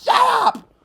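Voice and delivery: sudden high, loud "shut up"; angry shout. Real, not acted. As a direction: cut him off with a yell. Fan snapping at mocked manager.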